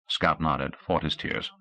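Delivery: ordinary delivery, nothing marked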